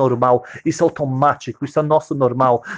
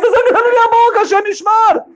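put-on much higher, louder crying child voice; wailing child; 5 dB louder than usual. Baby son crying about his bleeding mouth, high wailing. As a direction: wail it like a frightened little boy.

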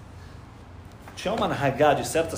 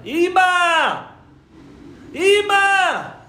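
crying out "Ima" at the top of his voice, much higher; a son's desperate cry at his mother's grave; anguished shout; 9 dB louder, much slower than usual. Retold or imitated. Father at Rachel's tomb calling out, anguished shout. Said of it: "começa a gritar".

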